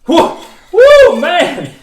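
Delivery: high, loud repeated whoa; hammed-up wonder at the sign; amazed whoa. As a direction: cry out in awe. Reacting to the second miracle.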